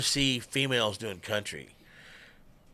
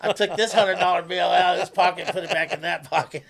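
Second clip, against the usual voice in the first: higher, louder voice breaking into laughter; laughing while talking; 7 semitones higher, 6 dB louder than usual. Real, not acted. Dad recounts daughter's cheap birthday pick, laughing. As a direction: tell it through a real laugh.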